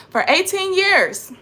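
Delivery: "For eighteen years." viel höher und lauter, empört; outraged. Real, not acted. Much higher louder repeat.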